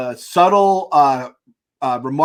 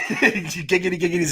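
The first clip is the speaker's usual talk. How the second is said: laughing mock giggity; laughing Quagmire impression; 4 semitones higher, 3 dB quieter than usual. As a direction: echo the catchphrase while cracking up.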